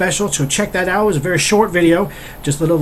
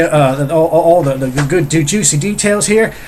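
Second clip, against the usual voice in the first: normal talking voice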